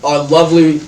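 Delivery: grand drawn-out denunciation; booming public speech. Acted. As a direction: proclaim it slowly to the whole city. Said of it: "his voice is booming".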